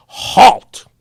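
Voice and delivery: loud, high little-general command; five-year-old general ordering the Jeep to stop; mock holler. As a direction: bark halt like a tiny general. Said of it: "he hollers".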